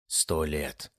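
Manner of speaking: low, slow and dark announcer voice; dramatic film narrator, dragging it out; ominous voiceover. A narrator's reading. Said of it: "вещал закадровый голос".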